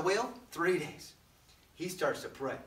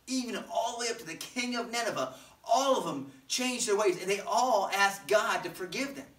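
ordinary talk, nothing marked

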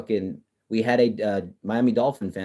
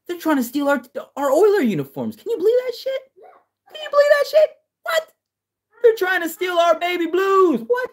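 squealing falsetto, much higher and louder; hammed-up outrage; 5 dB louder than usual. Fan to viewers about stolen baby blues, squealing mock outrage. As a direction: shriek it like a betrayed fan, half laughing.

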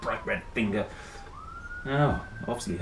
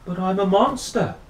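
higher, odd monster voice pleading; insist, almost hurt; monster's protest; 9 semitones higher, 5 dB louder than usual. A narrator's reading.

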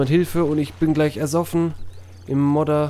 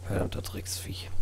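hissed curse under the breath; whispered curse; 12 dB quieter than usual. Real, not acted. Cursing at hidden enemy.

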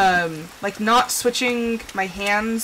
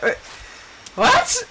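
baffled what; 14 semitones higher than usual. Retold or imitated.

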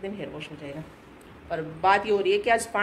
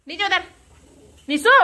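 calling out; 11 semitones higher, 8 dB louder than usual. Real, not acted. Calling daughter to come down.

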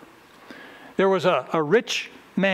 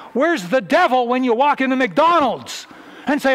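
louder, higher challenge to the congregation; mock-indignant preaching, louder and higher; rising preaching; 5 semitones higher, 5 dB louder than usual. Real, not acted. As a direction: press them hard on where their money goes.

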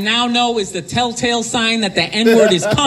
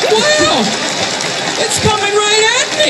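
mock dazed wonder; 8 semitones higher than usual. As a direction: gape like it's a 3D movie. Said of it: "like you're watching a movie like".

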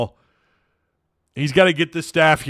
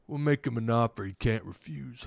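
put-on hushed, low Italian mobster accent; soft-spoken mock Godfather Italian; 3 semitones lower, 11 dB quieter than usual. Streamer imagines the coach making an offer, quiet Italian accent. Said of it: "I love doing the Italian accent".

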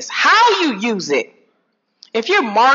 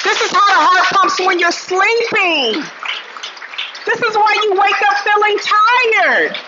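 worked-up urgency; 10 semitones higher than usual. Acted. Acting out a racing stressed heart.

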